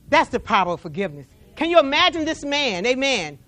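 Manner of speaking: usual speaking voice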